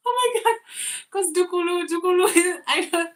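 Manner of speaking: can't get the name out for laughing; giggling disbelief. Real, not acted. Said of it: "I don't know if I'm pronouncing your name well".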